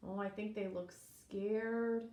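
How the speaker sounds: ordinary delivery, nothing marked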